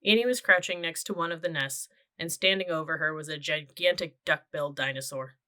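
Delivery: plain reading voice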